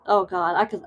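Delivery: ordinary delivery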